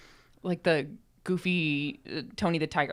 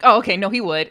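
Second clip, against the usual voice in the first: loud, higher quoted change of mind; hammed-up turnaround, louder and higher; mock conceding; 5 semitones higher, 12 dB louder than usual. Quoting friend convinced.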